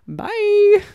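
high, loud breezy farewell; dismissive bye. Retold or imitated. Telling the audience how she blocked a troll, dismissive.

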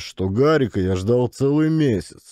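ordinary talk, nothing marked

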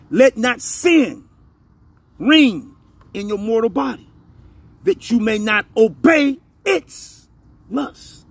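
slow, emphatic scripture in separated phrases; slow reading. Real, not acted. Preacher re-reading the verse for listeners, slowly and pointedly. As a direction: let each phrase of the verse stand alone. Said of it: "I'm gonna read it real slowly".

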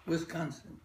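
ordinary talk, nothing marked

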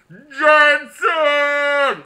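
high, loud drawn-out yell of the name; literal shout-out. Pretend. Giving a viewer his shout-out by yelling the name. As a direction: shout the name across an arena. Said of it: "I literally shouted it out".

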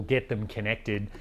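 usual speaking voice

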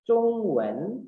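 CHUNG high and level, WEN dipping then rising; tone demonstration. Shown as a demonstration. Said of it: "Chung nada satu". ("Chung" tinggi datar, "Wen" naik)

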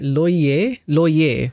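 "Loyer" as French, lwa-YAY style, ending in "ay"; "Loyer" the French way, no r heard; French pronunciation. Shown as a demonstration. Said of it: "How do you say that in French?".

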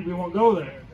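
ordinary delivery

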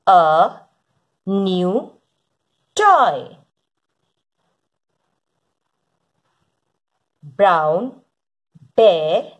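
deliberate early-reader storybook delivery, falling on each last word; slow word-by-word reading. Acted. Teacher reads to children following along, slow word-by-word reading. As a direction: read each word separately with long pauses.

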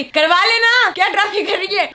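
a high, loud retold scolding from behind the curtain; mock scolding. Voice from behind curtains scolding the drama, high and loud. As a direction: snap at her like an impatient nurse. Voice scolding her during labour.

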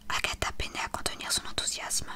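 plain reading voice

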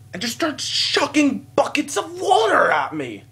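high, loud, slowed exclamation; incredulous outburst. Real, not acted. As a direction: cry it out in exaggerated disbelief. Retelling the water-throwing outrage.

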